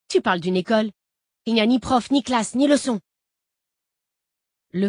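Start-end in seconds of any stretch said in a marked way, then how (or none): 0.1-3.0 heated, higher and louder retort; scornful anger. A narrator's reading. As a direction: throw the objection back indignantly. Gamin se moque de l'école. (riposte indignée, voix montée)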